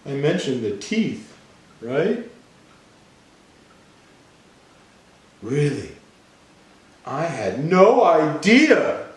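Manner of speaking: high-pitched cartoon pigeon, slowly drawn out; pigeon changing its mind. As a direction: read it as a high storybook pigeon, stretching words. Reads the pigeon's lines in the story.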